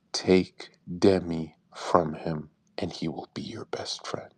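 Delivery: low, slow half-whisper telling a secret; hushed secret advice. Retold or imitated. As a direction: lean in and whisper the secret slowly. Private advice, secret tip.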